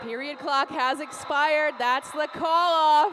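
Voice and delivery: loud, thrilled final call. Real, not acted. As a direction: shout the end of the period. End of the game call.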